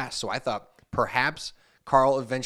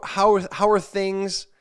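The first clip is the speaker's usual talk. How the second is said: slow, stumbling read of a broken text message, higher and louder; halting read-out; 7 semitones higher, 5 dB louder, much slower than usual. Retold or imitated. Reading out the scammer's message, slow and stumbling. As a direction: sound out the clumsy message word by word. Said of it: "it sounds almost like if you read it in Russian".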